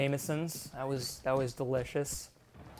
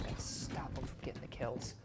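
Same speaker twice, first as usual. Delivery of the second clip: ordinary delivery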